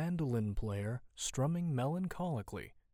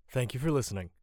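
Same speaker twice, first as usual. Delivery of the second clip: ordinary delivery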